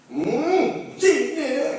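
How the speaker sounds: high, amused voice of the sheikh; lightly voiced Harun Din, amused; knowing sheikh. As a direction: reveal the jinn with a chuckle. Harun Din's knowing verdict.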